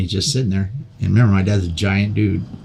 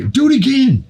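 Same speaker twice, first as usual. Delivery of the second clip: much higher, eager chant of the crowd; egging on; 3 dB louder than usual. Retold or imitated. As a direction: cheer him on to go again. Friends egging him on.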